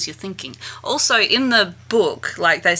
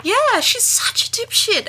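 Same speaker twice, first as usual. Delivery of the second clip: mocking. Real, not acted. Mocking agreement, high voice.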